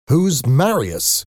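slow, high-pitched demand; incredulous. A narrator's reading. Father questioning his daughter about her lover, incredulous. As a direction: draw out the question in disbelief. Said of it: "Valjean repeated incredulously".

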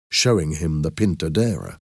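ordinary delivery

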